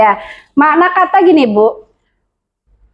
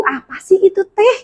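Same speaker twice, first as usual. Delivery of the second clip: mock confused; 6 semitones higher than usual. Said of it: "Dia tuh bingung".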